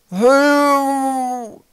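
high, loud muffled call; mouth-full voice. A narrator's reading. Swollen boy calls from behind Twig, mouth-full voice. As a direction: hoot it long, as if through a full mouth. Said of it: "Sie klang komisch, als ob Knorpel mit vollem Mund redete".

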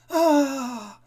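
high, loud scream of fright; screaming children. Acted. Children seeing the ugly monster, screaming high and loud. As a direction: let out a shrieking scream. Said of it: "hurlèrent les enfants".

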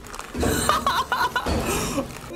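high wheezing laugh; cartoon laugh. Real, not acted. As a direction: cackle high and breathless. Laughing at clip.

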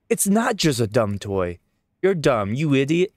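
loud, high, indignant shout with stress on not; angry child. Acted. Child yells at the adult defending the teddy bear, furious. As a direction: kid yelling back in a temper. Kid argues back at adult.

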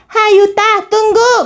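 high shout after someone leaving; calling out. Acted. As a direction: call him back before he goes. Calling after departing Yuta. (memanggil dengan berteriak)

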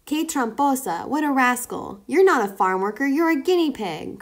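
loud indignant farmer; farmer crying out. A narrator's reading. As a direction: cry out at the sneaky guinea pig. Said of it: "cried the farmer".

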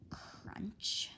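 breathy whispered sound word; whispered crunch. A narrator's reading. Narrator hushes the crunch of a step, whispered. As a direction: hush it like a crunch underfoot.

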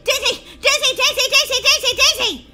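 high, loud calls, speeding up; panicked yelling. Acted. Molly calling her sister for help, frantic yelling. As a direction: yell the name over and over. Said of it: "what are you yelling about".

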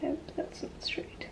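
whispered to herself; whispering. Real, not acted. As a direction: mutter it under your breath. Muttering while crafting.

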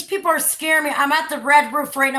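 loud, urgent cry for help; real alarmed shout for help; calling for help. Real, not acted. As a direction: call out loudly to be rescued. Calling out for help, being scared.

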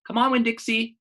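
high, loud call to a dog. Acted. Opal calling the dog across the store, high loud call. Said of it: "I said to the dog".

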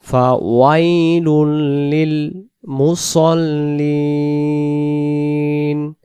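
ফাওয়াইলুল লিল মুসল্লিন slow, with the লি held long into নুন; drawn-out li. Shown as a demonstration. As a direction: stretch the লি before the ন. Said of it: "লি টান দিয়ে নুনের সাথে".

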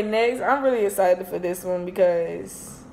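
ordinary delivery

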